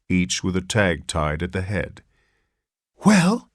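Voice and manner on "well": a high, breathless gasp; frightened father gasps the question. A narrator's reading. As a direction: gasp it out in shock. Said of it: "gasped Mister Button".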